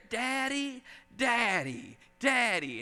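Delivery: put-on drawn-out calls of a little girl; child calling. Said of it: "she would keep calling me".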